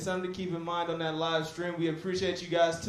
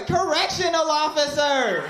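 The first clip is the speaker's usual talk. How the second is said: laughing mock crowd shout; 8 semitones higher, 6 dB louder than usual. Audience answer retold, laughing.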